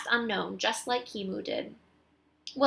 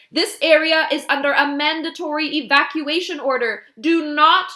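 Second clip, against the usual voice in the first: loud, raised official warning; an officer broadcasting to empty streets; loudspeaker announcement; 7 semitones higher, 10 dB louder than usual. A narrator's reading. Police officer warning the street over a loudspeaker, loud and higher. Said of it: "announces over a loudspeaker".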